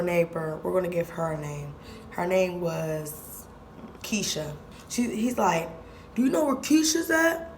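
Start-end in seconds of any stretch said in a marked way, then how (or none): 6.1-7.5 high put-on questioning voice; mock the clueless visitor; stranger asking. Stranger asks for Keisha.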